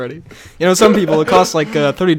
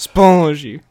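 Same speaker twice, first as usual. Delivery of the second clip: mock French-accented catchword; terrible French accent; 7 dB louder than usual. Teasing the co-host with Frenchie's word, bad French accent. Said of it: "That was a terrible French accent".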